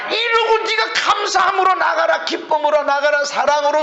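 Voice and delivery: high, driving sermon delivery; full-force preaching. Real, not acted. Preacher urging the congregation to resist the devil, full-force preaching.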